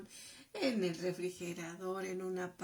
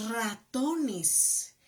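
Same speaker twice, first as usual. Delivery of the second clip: "ratones" said louder and set apart by pauses; single out "ratones" with a louder, set-apart delivery; stressing the R word; 3 semitones higher, 6 dB louder than usual. Shown as a demonstration. Mother singles out "ratones" to teach the R. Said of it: "ahí está la R".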